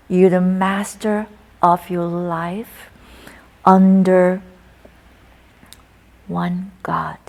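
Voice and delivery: ordinary delivery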